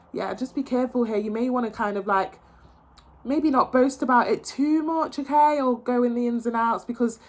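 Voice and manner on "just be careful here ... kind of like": ordinary talk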